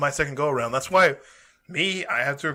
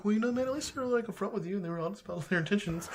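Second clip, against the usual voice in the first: high mock voice; 7 semitones higher, 7 dB quieter than usual. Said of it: "Scotty's like".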